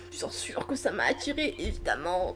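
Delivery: a soft conspiratorial évidemment; quiet knowing aside. Real, not acted. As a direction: drop évidemment under your breath like a private joke. Teasing herself about the romance.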